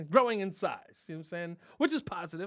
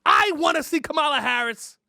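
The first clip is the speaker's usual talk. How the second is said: mock furious rant; 9 semitones higher, 10 dB louder than usual. Comic ranting at Biden about Harris, mock furious shouting. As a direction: rage at the president like a protester.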